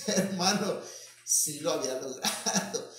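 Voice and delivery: chuckling through the words; amused laughing. Real, not acted. Preacher telling the congregation of the prank, laughing. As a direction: let laughter break into the telling.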